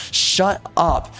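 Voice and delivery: usual speaking voice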